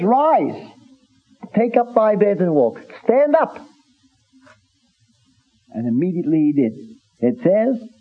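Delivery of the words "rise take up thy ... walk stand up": firm command, pitched higher. Acted. Preacher voicing the Lord telling the man to rise, commanding. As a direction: order him onto his feet. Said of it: "this was a commanding tone".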